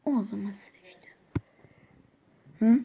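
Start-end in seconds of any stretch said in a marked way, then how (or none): 0.1-1.1 whisper. Acted. Teacher threatens class.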